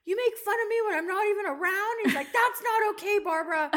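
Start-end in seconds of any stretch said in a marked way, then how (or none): 0.1-1.9 high, rapid indignant imitation; mock mad dad. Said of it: "He was all mad". 2.3-3.7 high, loud scolding imitation; mock mad dad. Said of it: "He was all mad".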